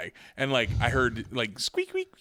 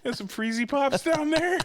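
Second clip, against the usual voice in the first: pretend kid; 9 semitones higher than usual. Playing the kid asking mom.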